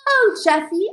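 high, lilting sweet-talk; gushing greeting. Retold or imitated. Child greeting mom's special friend, playfully gushing. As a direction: play up a coy, fond hello. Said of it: "And I go".

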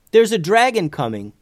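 high, loud urgent warning. Acted. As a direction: blurt it out in alarm. Boy warns of approaching dragon.

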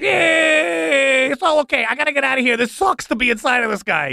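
squeal, then whine your complaint; wailing spirit. Acted. Spirit stuck inside this guy whines, upset.

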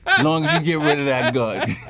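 laughing loudly through the words; can't stop laughing while saying it. Real, not acted. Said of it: "laughs through the line".

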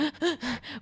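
quick imitated sniffling gasps; crying gasps. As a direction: imitate gulping sobs. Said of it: "I was crying".

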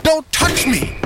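very high, frantic cry; panicked shriek. Acted. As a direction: shriek to fend him off. Doctor flinches from Teddy.

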